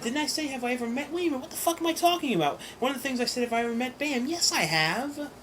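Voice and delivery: excited. Real, not acted. Suddenly remembering meeting Bam.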